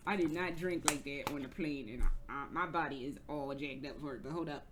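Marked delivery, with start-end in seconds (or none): none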